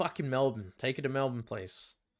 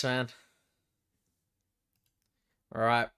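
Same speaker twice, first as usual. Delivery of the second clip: ordinary delivery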